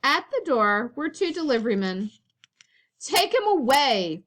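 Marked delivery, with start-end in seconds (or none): 3.0-4.2 firm. A narrator's reading. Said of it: "Father said firmly".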